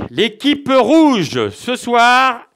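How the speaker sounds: slow, drawn-out game show host reveal; dramatic announcement. Acted. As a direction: stretch it out for suspense.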